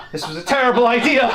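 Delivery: high, loud comic wail through laughter; laughing cartoon panic. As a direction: ham up a doomed chariot's cry. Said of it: "bumps into the giant and goes".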